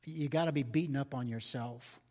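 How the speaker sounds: low, soft, tender comfort; Jesus comforting Peter after the denial; gentle reassurance. Pretend. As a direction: gently lift up a broken friend. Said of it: "Jesus looks at Peter and says".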